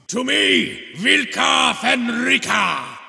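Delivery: high, held shout to the pack; rallying war cry. Acted. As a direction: call the wolves to you across the field. Rallying his warriors in battle.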